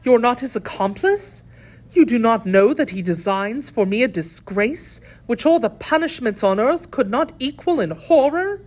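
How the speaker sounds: impassioned, horrified appeal; earnest lady prisoner. A narrator's reading. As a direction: ask with convincing earnestness, pitch climbing. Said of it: "with an indescribable accent of truth".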